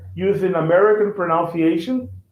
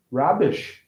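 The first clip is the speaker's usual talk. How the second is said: usual speaking voice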